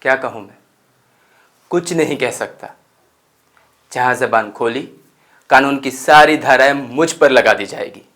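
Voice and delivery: loud, exasperated outburst; indignant protest. Acted. Narrator complains speaking out gets him charged, indignant. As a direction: protest loudly, building to the end.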